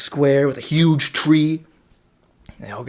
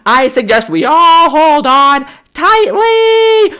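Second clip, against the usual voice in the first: mock conductor voice; 13 dB louder, much slower than usual. Conductor voiced in retold film.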